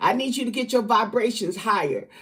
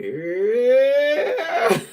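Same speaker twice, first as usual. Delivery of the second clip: laughing; 2 semitones higher, 3 dB louder than usual. Real, not acted. Laughing after calling her out.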